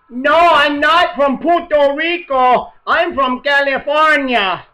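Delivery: high, loud and slow mock Sean Penn; a clueless actor answering, slow and high; imitating Sean Penn. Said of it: "the conversation went something like this".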